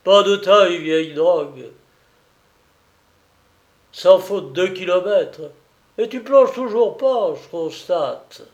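put-on high, lively old seaman voice; old Breton sailor, worked up and cheering; excited old sailor. A narrator's reading. Old sailor cheering as the enemy sub is hit, excited.

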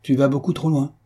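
controlled, firm reproof. A narrator's reading. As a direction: cut a friend off firmly. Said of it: "en interrompant son ami d'une voix ferme".

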